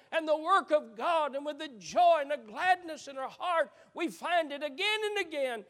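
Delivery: loud, high, swelling sermon climax; preaching at full force. Acted. Preacher praising the mother to the congregation, ringing out loud and high.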